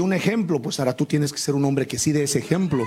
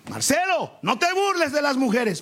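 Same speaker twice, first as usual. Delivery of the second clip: high calling mother's voice, higher pitch; a mother calling her son Marcelo, pitched high; mother's high scolding call; 8 semitones higher than usual. Retold or imitated. Mother calls son by name.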